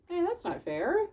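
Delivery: high protesting whine; indignant kid. Acted. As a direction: complain like a wronged child. Tobin protesting being blamed.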